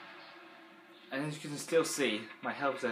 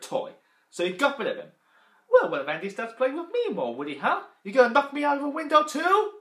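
high, loud accusing toy voice; Mister Potato Head voice; 11 semitones higher, 8 dB louder than usual. Retold or imitated. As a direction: confront Woody like a suspicious toy. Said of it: "my Mister Potato Head impression".

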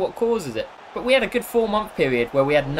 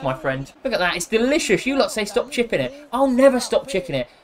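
normal talking voice

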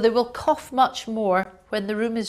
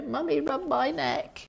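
put-on higher, slow, pleading little child; whiny child; 5 semitones higher, 4 dB quieter than usual. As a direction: beg mummy like a clingy sick kid.

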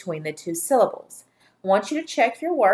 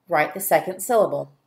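usual speaking voice

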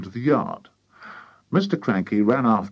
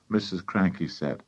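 ordinary delivery, nothing marked

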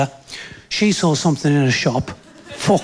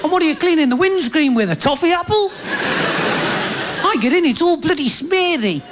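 high-pitched exasperated nagging; hammed-up tirade, voice pitched right up; blowing his top; 12 semitones higher than usual. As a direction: rant in mock outrage at your girlfriend. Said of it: "I blew my bloody top".

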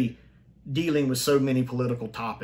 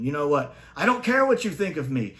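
imitated impassioned plea, rising in pitch; begging; 8 semitones higher, 3 dB louder than usual. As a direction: mock-desperate begging that climbs higher. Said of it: "begging them to let him save them".

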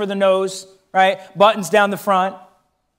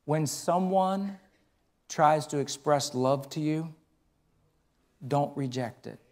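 gentle fatherly advice; 7 semitones lower, 12 dB quieter than usual. Retold or imitated. Father teaching his daughter, softly and tenderly. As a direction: counsel your child tenderly. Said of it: "I said".

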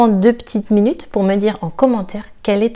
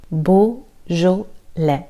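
"Beaujolais" drawn out very slowly, S silent; slow syllable drill; much slower than usual. Shown as a demonstration. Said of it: "Le S est silencieux".

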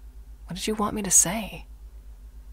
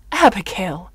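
loud, scolding name-call; shocked, reproving exclamation; sharp rebuke; 9 dB louder than usual. Acted. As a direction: cut them off with the name. Shocked rebuke at daughter's joke.

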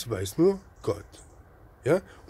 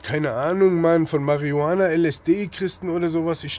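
louder and higher, nearly shouting; worked-up outburst; 6 semitones higher, 8 dB louder than usual. Real, not acted. Preacher rants at his listeners about drugs, loud and higher. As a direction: burst out as the rant boils over.

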